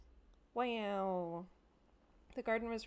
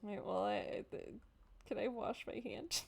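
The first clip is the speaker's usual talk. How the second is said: ordinary talk, nothing marked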